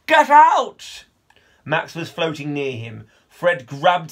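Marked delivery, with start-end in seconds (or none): 0.1-1.1 very loud, high desperate yell; panicked shout. Acted. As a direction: yell them out of the water. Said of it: "he yelled".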